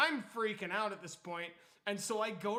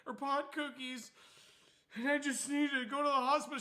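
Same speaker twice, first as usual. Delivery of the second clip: much higher, rushing; panicked confession; 7 semitones higher, 5 dB louder than usual. Retold or imitated. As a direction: blurt it out, scared.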